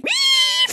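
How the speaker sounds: high-pitched squeal; a high, startled shriek; panicked squeal. Retold or imitated. Cicada down pants in gym.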